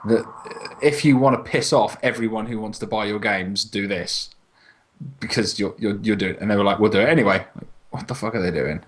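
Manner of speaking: ordinary delivery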